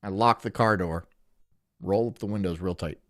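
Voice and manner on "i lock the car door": ordinary talk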